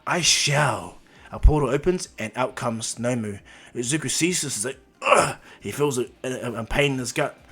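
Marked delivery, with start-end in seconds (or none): 0.1-1.0 lightly voiced villain, a bit louder; villain's reply. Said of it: "And he goes". 5.0-5.4 short, loud grunt of pain. Retold or imitated. Izuku feels sudden gut pain.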